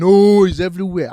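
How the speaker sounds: imitating a panicked crowd, loud; mock scream. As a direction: yell it like the crowd freaking out at the top. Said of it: "everybody will start screaming".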